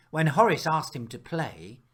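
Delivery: ordinary delivery, nothing marked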